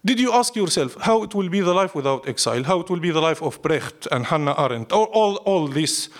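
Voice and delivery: ordinary talk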